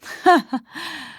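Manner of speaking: short high laugh; amused laugh. Acted. Lawyer amused by young sleuth's retort, light laugh.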